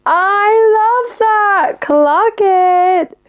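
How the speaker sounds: high, drawn-out delighted squeal; excited squeal. Real, not acted. Delighted reaction to listener's news.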